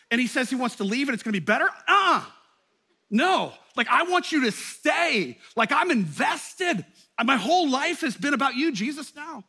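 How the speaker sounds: loud, high, desperate plea to stay; pleading protest. Pretend. Disciple begging Jesus to stay, loud high plea. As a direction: beg him not to leave, voice climbing.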